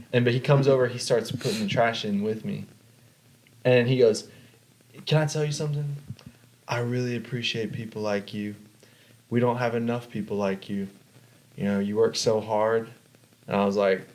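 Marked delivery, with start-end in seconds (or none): 5.1-12.9 lightly imitated creepy guy, hushed praise; creepy stranger. Strange man praising a worker by the dumpster, lightly voiced creepy stranger. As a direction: imitate a stranger's over-sincere compliment, a little softly. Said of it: "he's really giving me the creeps".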